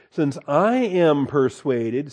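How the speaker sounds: "since I am persuaded" drawn out slowly, leaning on "persuaded"; slow it right down and weigh "persuaded"; slow, deliberate emphasis on "persuaded"; stress on persuaded. Shown as a demonstration. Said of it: "see that?".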